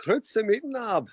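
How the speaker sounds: bright, high Swiss German greeting; Swiss German accent. Pretend. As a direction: greet everyone like a proud Swiss local. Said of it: "my new Swiss German accent".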